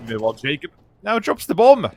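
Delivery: brisk live play-by-play; esports caster. Acted. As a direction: call each move as it happens. Casting the round live.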